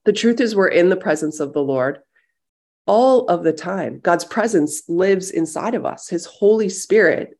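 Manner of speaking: ordinary delivery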